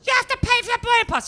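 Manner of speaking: high, loud, fast mock outrage; incredulous check-in woman. Check-in woman who couldn't believe he hadn't printed it, mock outraged. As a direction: put on a shrill-pitched, rushed airline clerk.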